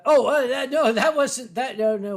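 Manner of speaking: loud, high stammering protest; flustered denial. Pretend. Streamer denying the lap dance story, flustered denial.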